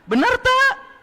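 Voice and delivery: high, loud call to the crowd; rally speech. Real, not acted. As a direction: demand the crowd's answer. Asking crowd.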